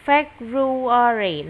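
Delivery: "February" drawn out syllable by syllable; slow, loud model of "February"; slow pronunciation. Shown as a demonstration. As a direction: say "February" slowly and loudly for a learner. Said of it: "Đọc nhanh lại".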